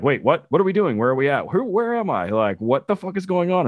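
high-pitched confused dog's questions; mock bewilderment. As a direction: act out a baffled dog, pitch jumping up. Voicing a confused dog's imagined questions.

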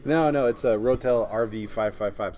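normal talking voice

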